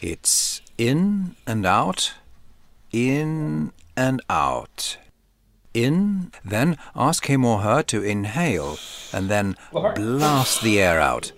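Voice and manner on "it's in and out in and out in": very slow, drawn-out in and out; slow breathing cue. Shown as a demonstration. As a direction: pace the breaths slowly. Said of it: "pacing tidal breaths".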